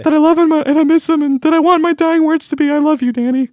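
high falsetto woman's voice; man doing a woman's voice. Pretend. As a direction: squeak it out like a girlfriend on the phone. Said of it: "Do you like my girl voice?".

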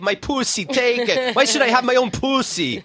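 high-pitched mock Israeli accent. Playing the Israeli tourist stripping for workers, Israeli accent. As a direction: plead it in a hammed-up Israeli accent. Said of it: "read this in an Israeli accent".

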